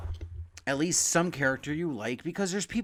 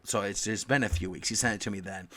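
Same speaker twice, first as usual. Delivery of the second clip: ordinary talk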